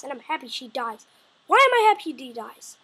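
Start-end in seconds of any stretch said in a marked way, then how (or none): none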